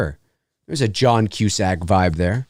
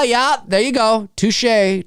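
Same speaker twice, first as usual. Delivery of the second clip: ordinary delivery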